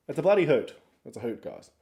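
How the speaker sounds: ordinary talk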